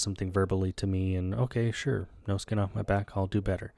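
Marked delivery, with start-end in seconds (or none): none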